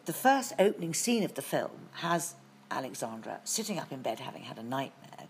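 ordinary delivery

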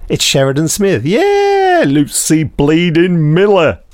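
ordinary talk, nothing marked

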